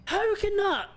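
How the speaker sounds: baffled outburst. Real, not acted. Baffled at being killed.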